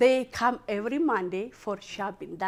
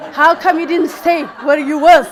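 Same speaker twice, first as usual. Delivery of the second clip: laughing scold; 7 semitones higher, 15 dB louder than usual. Retold or imitated.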